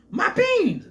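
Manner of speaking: high, loud squealing "My beans!"; mock Jorgen yell. Imitating Jorgen to a viewer, a high loud squeal. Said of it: "that's how he says that".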